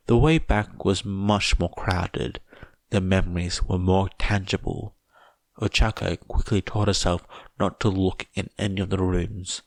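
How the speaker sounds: plain reading voice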